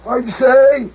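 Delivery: 高声惊问; 猛地拔高嗓门惊叫. Acted. Hears sweetheart is leaving.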